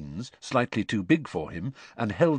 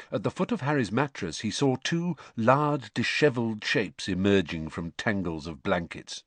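usual speaking voice

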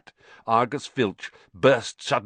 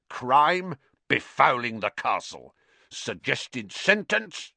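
raised voice reading out charges; officious caretaker; 5 semitones higher, slower than usual. A narrator's reading. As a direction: dictate the charge, lifting each question. Caretaker filling in punishment form.

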